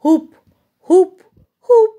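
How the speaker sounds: loud short hoop calls; monkey hoots. Shown as a demonstration. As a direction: hoot like a monkey for the child. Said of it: "Hanuman কেমন করে ডাকে?". (জোরে হনুমানের হুপ হুপ ডাক)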